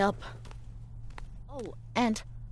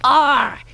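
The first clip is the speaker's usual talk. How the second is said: loud mock pirate roar; playful battle roar; pretend battle cry; 6 semitones higher, 15 dB louder than usual. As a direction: roar like a kid playing war. Child pretends to fight machines.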